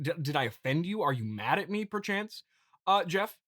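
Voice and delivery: normal talking voice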